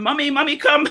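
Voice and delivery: high mock call of a little boy for mommy; child calling out. Son calling his mother over to meet the governor, high and carrying. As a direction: call out like a child wanting mom. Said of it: "my child is calling".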